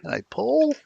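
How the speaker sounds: high Aussie g'day; Australian accent. Acted. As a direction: greet him as an Aussie. Aussie greeting to Perth viewer.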